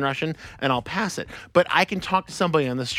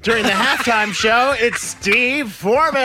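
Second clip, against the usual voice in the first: upbeat radio presenter bringing on the guest; radio host intro; 6 semitones higher, 4 dB louder than usual. Acted. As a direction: announce it like a lively DJ.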